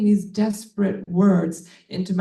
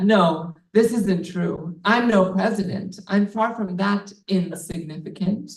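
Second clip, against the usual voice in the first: ordinary delivery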